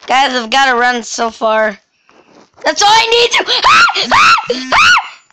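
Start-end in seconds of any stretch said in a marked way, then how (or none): 2.6-5.2 sudden high shrieking screams; panicked screams. Real, not acted.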